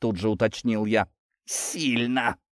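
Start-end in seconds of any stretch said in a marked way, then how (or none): 1.5-2.4 strained word on an outgoing breath; exhaled. A narrator's reading. Hurt worker answers about the fire, exhaling it. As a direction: breathe it out in pain. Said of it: "выдохнул Тихон".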